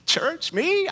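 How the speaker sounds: high squeak of mock disbelief, dropping to near breath. Friend asked to church, squeaks in mock shock. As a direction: play it incredulous, pitch jumping up.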